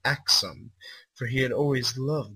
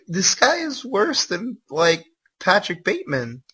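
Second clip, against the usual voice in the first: stunned, high-pitched outrage; appalled disbelief; 8 semitones higher, 7 dB louder than usual. Real, not acted. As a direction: gasp it out in horrified disbelief. Reacting to a disturbing fanfic passage.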